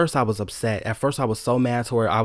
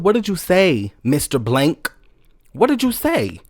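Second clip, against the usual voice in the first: higher, louder, challenging; indignant demand; 4 semitones higher, 4 dB louder than usual. Retold or imitated. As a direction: demand he repeat it. Confronting manager.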